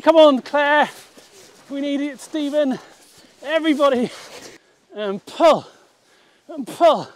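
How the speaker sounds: high rallying calls; tug of war. Real, not acted. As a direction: cheer the team on to pull. Rallying the team to pull.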